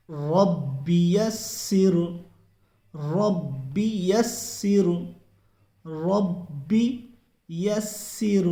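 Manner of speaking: drawn-out Quranic recitation, very slow. Shown as a demonstration. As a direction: recite each word slowly and melodically. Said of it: "تو چلیے پڑھتے ہیں". (آہستہ تلاوت کا انداز)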